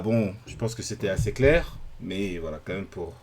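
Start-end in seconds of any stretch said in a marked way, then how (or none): none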